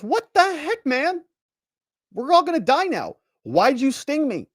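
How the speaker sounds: very high-pitched dismayed protest; hammed-up cry of a betrayed frog; outraged frog. Voices stung, dying frog's outrage.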